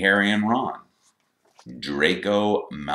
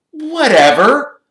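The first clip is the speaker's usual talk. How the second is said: very high, loud, drawn-out word; dismissive; 13 dB louder, slower than usual. A narrator's reading. Malfoy brushing off Ron's correction, dismissive. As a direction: fling it out slowly, sky-high and loud.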